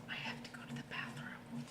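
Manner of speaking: very soft breathy whisper; scared whisper. Acted. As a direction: barely breathe it, hiding. Scared elephant hiding.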